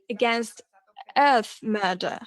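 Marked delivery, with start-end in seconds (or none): none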